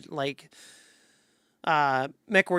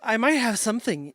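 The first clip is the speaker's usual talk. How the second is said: ordinary talk, nothing marked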